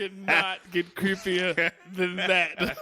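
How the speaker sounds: slow, laughing creepy impression; mock creepy voice. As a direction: drag it out, spooky and amused. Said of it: "And I was like".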